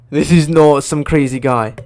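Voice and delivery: loud, forceful protest; emphatic insistence. Real, not acted. Speaker defending the thirty thousand references, loud insistence. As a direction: drive it home loudly.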